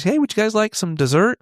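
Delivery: high, chirpy waitress imitation. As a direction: lift up into a bright server voice. Waitress voiced in story.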